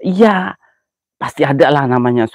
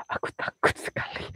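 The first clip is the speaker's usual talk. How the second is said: breathless scared whisper; mock-terrified whisper in the dark; frightened whisper; 16 dB quieter than usual. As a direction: whisper it like something is behind you. Scared ghost hunter at night.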